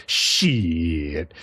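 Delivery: long, low drawn-out curse; retold stunned realization. Hero learns of concealment spell, lowly amazed. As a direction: draw it out low, as the hero putting it together. Acting out hero's impressed realization.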